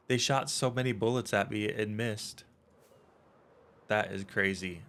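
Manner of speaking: ordinary talk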